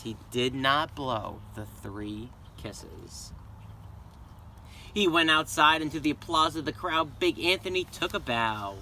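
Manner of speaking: ordinary delivery, nothing marked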